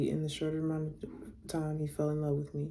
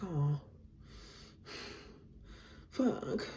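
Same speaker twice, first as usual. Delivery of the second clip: heartstrings pulled; 6 dB quieter than usual. Real, not acted. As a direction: sigh it out, torn by his words. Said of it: "it's pulling at my heartstrings".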